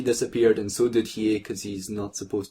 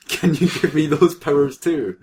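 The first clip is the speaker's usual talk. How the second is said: mock plea; 5 semitones higher, 6 dB louder than usual. Reading fanfic, voicing the boy.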